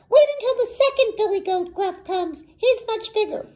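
high, quick little goat; very small goat voice. A narrator's reading. Youngest goat putting off the troll, very small voice. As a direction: bargain in a tiny high voice. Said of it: "in his very small voice".

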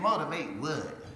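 low, slow, doubting; skeptical lady. Retold or imitated. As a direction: scoff at the job title. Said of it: "She said".

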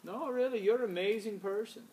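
put-on high-pitched vague foreign accent; admiring co-host in an unplaceable accent; Marcio. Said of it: "didn't know where his accent's from".

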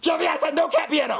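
high-pitched angry reminder; exasperated shout. Acted. Dad forbidding cat piano, exasperated shout. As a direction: yell over the toy. Father forbids noisy toy.

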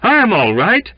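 impassioned outburst, rising to a high cry; agitated protest. A narrator's reading. Sick man's frantic protest.

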